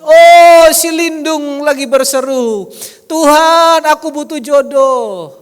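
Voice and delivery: loud, high, slow booming voice of God; voicing God. God noticing a congregant's prayer, loud slow booming. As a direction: boom it out as God watching from above. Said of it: "Tuhan dari tempat ... dia lihat".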